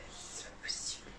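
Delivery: barely breathed out in a whisper; whispered disbelief. Real, not acted. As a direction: mutter it in a whisper, in shock. (chuchoté, incrédule)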